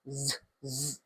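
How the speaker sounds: "z, z" as two low, soft buzzes; Z sound. Shown as a demonstration. Teacher showing the Z sound, Z sound. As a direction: buzz each "z" separately. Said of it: "Z sound like".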